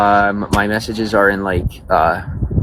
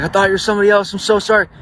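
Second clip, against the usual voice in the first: much higher mock female voice; imitated apologetic woman; 12 semitones higher, faster than usual. Embarrassed woman to the wrong man, high apologetic imitation. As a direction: apologize in a high, put-on girl's voice. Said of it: "and she's like".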